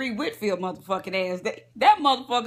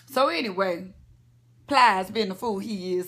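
ordinary talk

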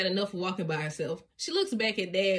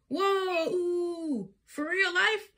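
high, eager squeals of delight; a thrilled little girl who can't believe it; excited child; 9 semitones higher, slower than usual. A narrator's reading. Bluey thrilled to walk alone, high excited squeals.

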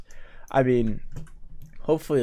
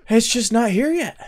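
raised, louder, impatient whine; exasperated complaint; 8 semitones higher, 6 dB louder than usual. Real, not acted. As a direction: complain loudly about the endless wait. Impatient over undelivered pizza.

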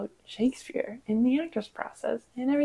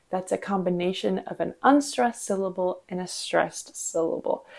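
usual speaking voice

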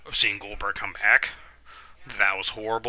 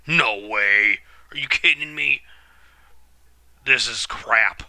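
angry disbelief; 6 dB louder than usual. Real, not acted. Angry at the match.

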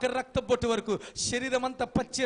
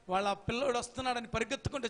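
ordinary talk

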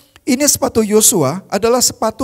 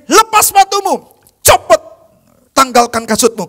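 higher and louder sharp command; stern commander; 5 semitones higher, 4 dB louder than usual. Retold or imitated. Heavenly commander ordering Joshua to take off his shoes, stern. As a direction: order it with authority. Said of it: "Panglima Sorga bilang ini".

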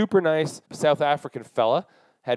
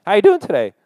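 louder and higher, pushing the friendliness; overdone friendly greeting; 7 semitones higher, 9 dB louder, faster than usual. Retold or imitated. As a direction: greet them again, bigger and brighter. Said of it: "Just testing the waters".

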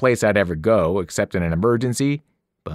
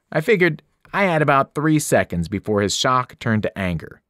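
plain reading voice

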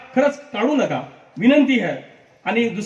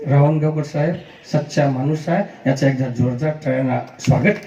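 deep, measured politician voice, much lower; Sharad Pawar impression; 6 semitones lower than usual. Imitating Sharad Pawar to honour Ravangavkar at a felicitation. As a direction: drop low and speak like a senior statesman. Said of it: "Sharad Pawarji म्हटले असते तर काय म्हटले असते?".

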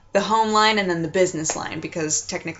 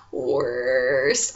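normal talking voice

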